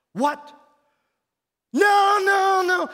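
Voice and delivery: very high, very loud cry of no; shocked shout. Retold or imitated. Guard screaming no when told the bottle is gone. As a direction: scream it out in horror.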